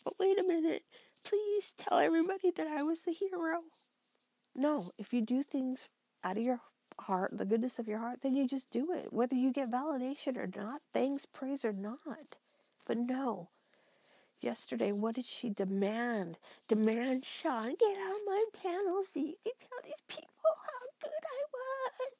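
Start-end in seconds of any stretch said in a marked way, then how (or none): 0.0-3.6 high, whiny mock plea. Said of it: "you have to be like". 16.7-22.1 mock her tearful demand; mock crying demand.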